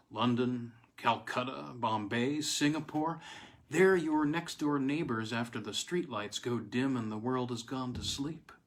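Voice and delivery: plain reading voice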